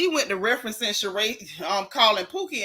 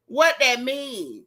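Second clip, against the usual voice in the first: What that mean? dragged out slowly, every word weighed; slow mocking repeat; much slower than usual. Shown as a demonstration. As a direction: stretch out What that mean? to mock her. Repeats the question for effect.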